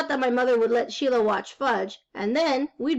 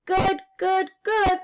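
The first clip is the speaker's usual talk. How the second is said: put-on high toddler chant; little boy voice; 7 semitones higher, 3 dB louder, slower than usual. A narrator's reading. Little boy promising to be good, high childlike voice. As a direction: repeat it like a small child. Little brother echoes his mother.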